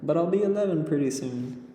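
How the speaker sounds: put-on high, quick little girl; young girl boasting about her age; girl character voice. A narrator's reading. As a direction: say it proudly like a child.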